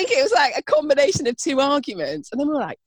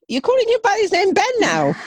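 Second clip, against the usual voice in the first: high, loud, incredulous question; teasing disbelief; 9 semitones higher, 6 dB louder than usual. Real, not acted. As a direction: call her out in amused astonishment. Teasing co-host about Ben's name.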